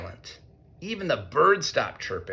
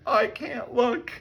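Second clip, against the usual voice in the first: scared mole; 11 semitones higher, slower than usual. A narrator's reading. Mole covering eyes.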